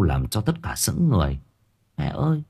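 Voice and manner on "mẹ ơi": put-on high, innocent-sounding child with an eerie edge; uncanny childlike question; eerie little boy. A narrator's reading. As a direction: ask mother in a small, high child's voice. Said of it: "cái giọng nói của thằng bé nó có một cái gì đó ma quái lắm".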